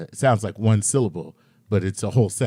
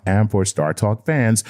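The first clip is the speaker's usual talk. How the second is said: normal talking voice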